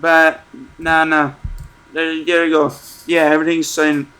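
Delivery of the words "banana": Banana stretched very slowly, each syllable held; slow deadpan Banana; drawn out. Shown as a demonstration. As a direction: drag Banana out slowly for comic effect. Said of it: "I'm just gonna say".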